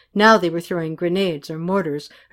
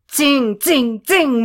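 high, loud ringing zings; pitched-up bullet noises; imitated bullets; 6 semitones higher, 5 dB louder, much slower than usual. A narrator's reading. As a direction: make bullets fly past.

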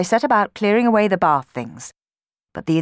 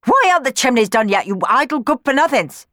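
louder, fast scolding of the sweeps; shrill housekeeper scolding her idle sweeps, higher and fast; angry demand; 8 semitones higher, 6 dB louder than usual. Acted. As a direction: rattle off a furious telling-off. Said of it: "she demanded".

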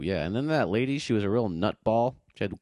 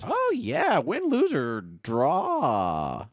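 much higher hyped announcer; announcer hype; 6 semitones higher, much slower than usual. Retold or imitated. Quoting a kids' game show, high hyped announcer. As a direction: sell it like a game show opener.

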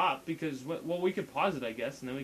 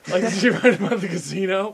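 laughing; 4 semitones higher, 12 dB louder than usual. Real, not acted. Mocking a posted poem.

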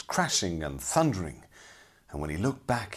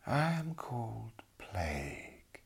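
slow, breathy eerie whisper; Lady Plague whisper; 3 semitones higher, 8 dB quieter, slower than usual. A narrator's reading. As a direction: hush it out like a ghostly lady. Lady Plague introduces herself.

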